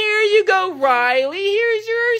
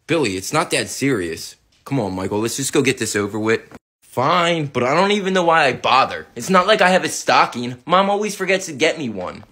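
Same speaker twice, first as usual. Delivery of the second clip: put-on much lower, bored teen; a sulky older brother. Older brother grumbles to Billy about stockings, low.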